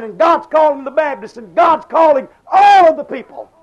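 rising revival cry, loud and driving; preaching at full force. Acted. Preacher calling every church to repentance, full force. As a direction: call the churches out like a tent-meeting preacher.

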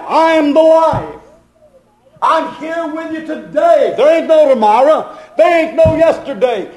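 high, sustained preaching cry; full-force preaching. Acted. Preacher voicing Jesus saying he is here today, high full-force preaching. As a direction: proclaim it urgently on a high, held pitch.

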